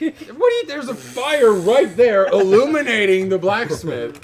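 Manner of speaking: high, loud, slow protest; mock-indignant blacksmith. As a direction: act offended at the question. DM objects in character, mock outrage.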